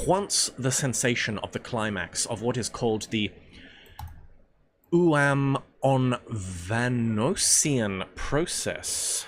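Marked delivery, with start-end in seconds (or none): 4.9-9.2 very slow, drawn-out syllables of Uamonvanosian; Uamonvanosian sounded out. Shown as a demonstration. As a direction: stretch Uamonvanosian piece by piece. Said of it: "I said it right the first time".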